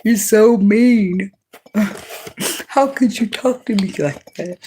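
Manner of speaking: hammed-up hurt whine; whiny mock victim. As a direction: imitate someone whining that you're mean. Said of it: "when you start acting like that".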